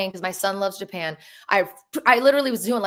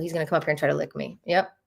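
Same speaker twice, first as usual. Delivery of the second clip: usual speaking voice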